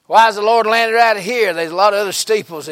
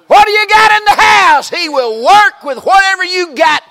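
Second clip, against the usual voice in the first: much higher, louder preaching cry; preaching shout; 12 semitones higher, 8 dB louder than usual. Real, not acted. Preacher shouts to the church, high and loud. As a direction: hammer it home at full voice.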